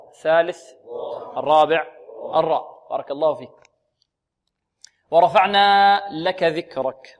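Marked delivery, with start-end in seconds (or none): 5.1-7.2 slow, loud, chanted recitation; Quran recitation. Shown as a demonstration. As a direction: recite the verse slowly and fully. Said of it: "recites verse". (تلاوة مرتلة بصوت مرتفع)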